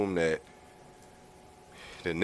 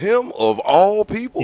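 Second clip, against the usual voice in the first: shocked; 12 semitones higher, 7 dB louder than usual. Retold or imitated. Shocked reaction to headline.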